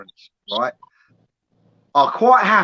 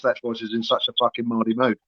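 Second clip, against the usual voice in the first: usual speaking voice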